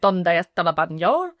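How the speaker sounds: lightly put-on Spanish accent; hint of a Spanish accent. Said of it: "in that Spanish accent".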